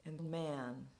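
ordinary delivery, nothing marked

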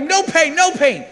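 high, loud rallying chant; re-enacted motivational scream; screaming coach. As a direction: yell the motto at your exhausted wife. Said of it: "I'm screaming up the mountain".

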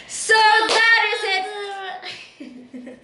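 slow, high, shouted "So that is it"; slow shouted outro. Shown as a demonstration. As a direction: draw it out and shout it. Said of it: "One, two, three".

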